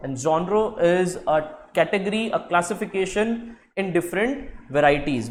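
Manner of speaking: And genre is a category read out very slowly; slow dictation. Shown as a demonstration. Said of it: "slow dictation of definition". (धीरे धीरे परिभाषा लिखवाना)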